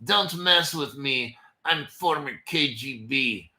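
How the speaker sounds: put-on low, slow Russian tough-guy accent; a menacing ex-agent in a mock Russian accent. Host posing as a former KGB man, low mock Russian accent. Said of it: "that's my Russian accent".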